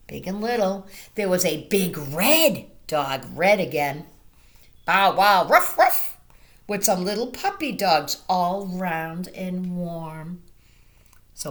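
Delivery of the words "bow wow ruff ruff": loud barking dog. Acted. Reading to children, a loud dog bark. Reading farm animal noises.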